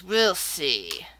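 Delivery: low reply. Acted. Old man to his challenger, low understated reply. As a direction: answer the boast low and understated. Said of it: "And he says".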